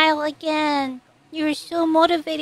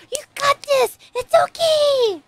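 high cheer; 10 semitones higher than usual. Real, not acted. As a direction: cheer him on, bright and high-pitched. Cheering on the character.

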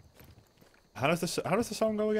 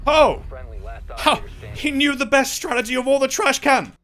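excited; 10 semitones higher, 5 dB louder than usual. Real, not acted. Cheering a teammate's play.

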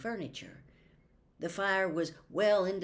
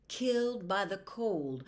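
normal talking voice

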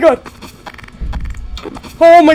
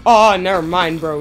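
ordinary talk, nothing marked

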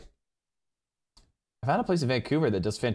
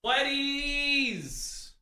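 high, long drawn-out name; excited call. Real, not acted. Streamer calling out a viewer who sent a drink, high and drawn out. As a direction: sing the name out with delight.

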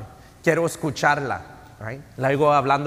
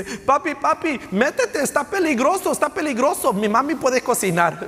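put-on much higher, pleading little girl's voice; 11 semitones higher than usual. Daughter begs her dad to come in from the storm, high and pleading. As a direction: squeak it out like a scared small daughter calling her dad. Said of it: "la niña viene corriendo y le dice".

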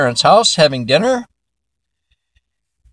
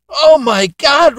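slow, higher, louder "Oh my God"; disgusted outcry; 11 semitones higher, 4 dB louder, slower than usual. Acted. Dad at dinner groans at the stink, high, slow and louder. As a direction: drag it out in high, loud disgust.

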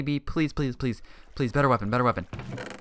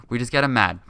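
normal talking voice

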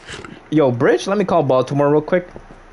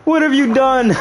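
dismayed; 9 semitones higher than usual. Real, not acted. High dismayed question.

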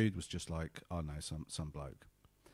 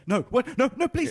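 loud, very high panicked pleading; mock crying and screaming; 12 dB louder than usual. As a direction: imitate a man squealing for mercy. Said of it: "He starts crying and starts".

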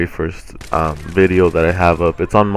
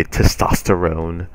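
slow, put-on macho Testosterone; mock manly voice; slower than usual. Vlogger repeats the joke word to viewers, slow mock macho delivery. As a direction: drag out Testosterone as a joking macho punchline.

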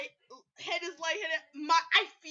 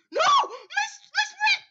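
higher, louder panicked cry; dismayed shout; 10 dB louder, slower than usual. Real, not acted. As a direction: wail about losing the sprint. Losing sprint in game.

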